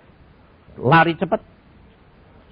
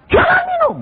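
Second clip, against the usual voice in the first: squeaky high mock voice; mocking high refusal; 6 dB louder than usual. Preacher mocking a believer who refuses, squeaky high. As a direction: mimic a fussy refuser. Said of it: "jangan kamu bilang".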